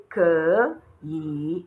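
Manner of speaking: 可以 dragged out, each syllable dipping and rising; dragged third tones. Shown as a demonstration. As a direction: drag both syllables of 可以 slowly through a full dip. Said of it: "when you have to drag these tones twice".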